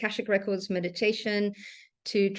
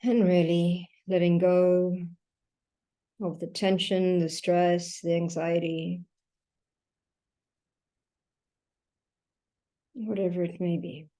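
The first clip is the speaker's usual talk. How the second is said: guided meditation. Acted. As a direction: guide them gently through each breath, leaving long silences. Leads a breathing meditation.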